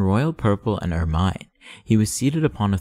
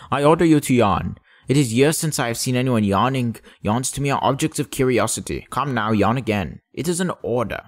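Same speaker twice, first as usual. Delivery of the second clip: commanding king; 3 semitones higher than usual. Acted. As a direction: bark out the royal order.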